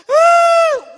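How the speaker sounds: put-on high, loud child's wail; wailing cry. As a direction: burst out wailing in terror. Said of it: "울었어요".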